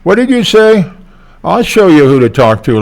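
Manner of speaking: a gullible fox flaring up at an insult; angry Brer Fox. Retold or imitated. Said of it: "gets pissed off at the, uh, the Tar Baby and says".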